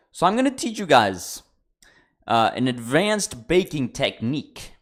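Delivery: loud, high showman announcer voice; mock TV host. Announcing an advanced baking technique to viewers, loud hammed-up host. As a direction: sell it like a cooking show.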